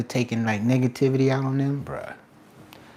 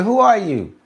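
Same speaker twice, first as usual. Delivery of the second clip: ordinary talk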